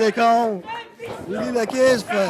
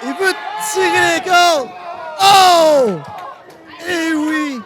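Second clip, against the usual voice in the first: wrestling commentator; 5 semitones higher than usual. Acted. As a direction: build the hype for the big move. Live wrestling play-by-play. (commentateur de catch qui s'emballe)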